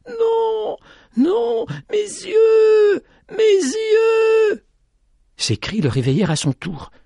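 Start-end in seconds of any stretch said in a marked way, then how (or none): 0.1-4.7 crying out very high and loud; screaming. A narrator's reading. Petit-Jean wakes screaming in the storm, terrified. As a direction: scream in terror about his eyes. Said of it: "crier de toutes ses forces".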